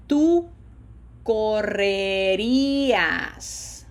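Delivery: slow, drawn-out Spanish model, each vowel held long; pronunciation drill. Shown as a demonstration. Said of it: "Another challenge in pronunciation here".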